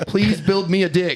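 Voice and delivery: laughing while pleading; giggly robot plea. Pretend.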